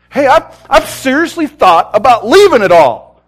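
high, loud outburst; urgent confession. Retold or imitated. Wavering believer in the chair admits doubts, urgent outburst.

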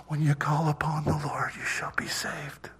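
very soft, slowing into a whisper; little small voice. Retold or imitated. Inner voice speaking to a tormented dying man, little small whisper. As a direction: hush it down to a whispered promise. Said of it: "a little small voice says".

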